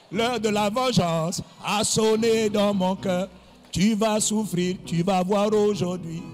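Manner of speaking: slow, loud menacing big brother; villainous revenge. Retold or imitated. Big brother threatening the little tattler once mum leaves, slow loud mock menace. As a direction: promise revenge slowly like a movie villain.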